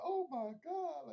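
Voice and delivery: drawn-out mock shocked Ellie; imitating Ellie. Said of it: "She's like".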